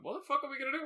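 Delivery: high-pitched, lightly acted panic; mock bewildered panic. Hosts baffled by the movies, a high mock panic. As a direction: toss it off like a half-joking freak-out. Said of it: "just went".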